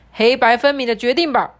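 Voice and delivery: loud, insistent demand; bossy animal voice. Acted. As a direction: lean on the zebra to decide.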